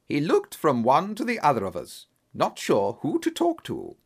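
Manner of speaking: plain reading voice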